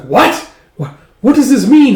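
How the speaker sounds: high, loud disbelief; acted shock, voice leaping up; shocked outburst. Uncle reacts to shocking news.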